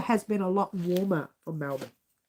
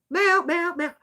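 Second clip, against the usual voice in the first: cat meowing; 7 semitones higher than usual. Retold or imitated. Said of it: "she talks to me".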